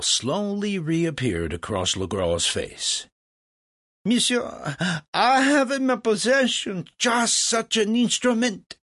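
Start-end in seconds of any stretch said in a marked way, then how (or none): 4.0-8.8 high, loud French crowing; boastful French impresario. Acted. Legras reveals he owns the instrument, boastful and loud. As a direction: announce the prize triumphantly.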